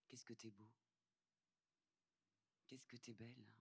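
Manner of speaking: gentle praise to a stranger in exile; tender compliment. Acted. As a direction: murmur it close, like a gift. Offering flowers, complimenting.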